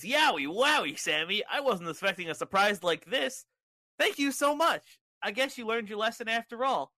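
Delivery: high, hammed-up happy shock; Bray Wyatt. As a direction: gush thanks like an amazed kids'-show host. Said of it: "Home Alone shocked face and says".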